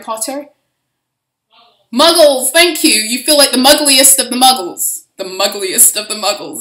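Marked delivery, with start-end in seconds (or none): 1.9-2.9 loud, high burst of recognition; triumphant loud exclamation; excited outburst. Real, not acted. Excited answer to her own question. 2.9-5.1 louder, gleeful delivery; punchline said big and bright; amused loud emphasis. Real, not acted. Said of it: "That's pretty fucking funny".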